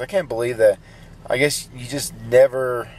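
usual speaking voice

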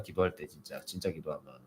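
ordinary talk, nothing marked

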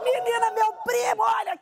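excited high-pitched shout; calling out. Retold or imitated. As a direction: call out like you found treasure. Voicing Kelly calling out.

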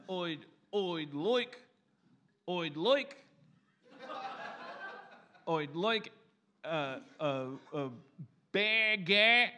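halting broad Australian run-up; Australian accent attempt. Shown as a demonstration. Comic trying a new phrase, tentative exaggerated Australian accent. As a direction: feel out the Australian vowels, stopping and restarting. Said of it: "try it with a different phrase".

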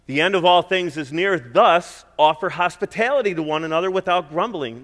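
loud, proclaiming read of the verse; preaching scripture. Acted. Pastor reading the verse aloud to his church, preaching. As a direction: declare the verse.

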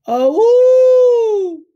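high, loud ghostly dog howl; a long, high, loud howl of a dog. Acted. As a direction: howl like a dog calling from the trees. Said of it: "He'd heard a howl".

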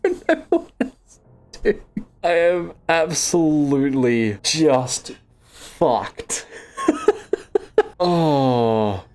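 slow, laughing through the words; laughing despair. Real, not acted. Player stranded at night, laughing helplessly. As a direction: laugh at your own hopeless situation. Stuck outside overnight, laughing at it.

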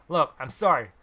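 loud, firm I'm sorry; loud refusal. A narrator's reading. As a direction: shut him down loud. Refusing pushy stranger.

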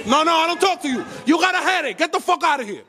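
high, heated brush-off; angry dismissal. Real, not acted. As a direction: send them away, pitched high and irritated. Angrily dismissing the woman.

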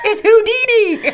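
high, loud, laughing cry; mock triumphant reveal. Houdini revealing himself to the medium, high mock-triumphant shout. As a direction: draw out the big unmasking gleefully.

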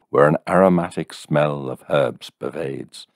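ordinary delivery, nothing marked

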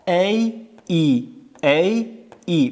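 "ای" alternately as "ey" and "ee", slowly; alternating vowel drill. Shown as a demonstration. Teacher drills fifth and sixth alef, slow "ey, ee" on "ای". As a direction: draw out "ای": first gliding "ey", then pure "ee".

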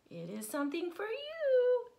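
teasing gift voice. A narrator's reading. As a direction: tease him with the surprise. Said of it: "said Father Lion".

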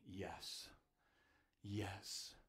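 very soft, low yes; soft reassurance. Acted. The Lord answering the prophet, soft reassuring yes. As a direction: answer gently, almost whispered.

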